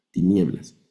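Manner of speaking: ordinary talk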